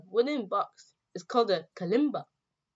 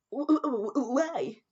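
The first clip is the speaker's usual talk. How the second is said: high, stammering the first sound over and over; nervous stammer; 7 semitones higher, 3 dB louder, slower than usual. Acted. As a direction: trip over the why like he's been caught. Michael stammers, caught out nervously.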